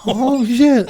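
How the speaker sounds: high-pitched playful dismay; acted uh-oh moment; mock alarm. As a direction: react in exaggerated alarm. Imagined reaction to angry text.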